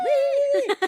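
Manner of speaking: high loud whoop of joy; excited cheer. Retold or imitated. Fans at the concert starting, cheering a high loud woo. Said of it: "we were like".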